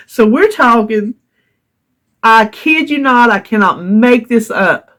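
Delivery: ordinary talk, nothing marked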